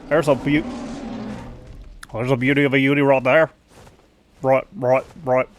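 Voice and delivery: put-on tentative Aussie accent; Australian accent. Practising the phrase, Australian accent. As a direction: practise broad Australian vowels. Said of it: "I can't do the accent".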